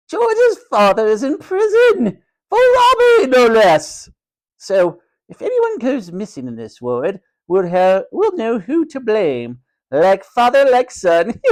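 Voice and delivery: very high, loud proclamation, drawn out with relish; cruelly gloating matron. A narrator's reading. Matron exposing George's father to the ward, loud haughty gloating. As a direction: announce his shame to everyone.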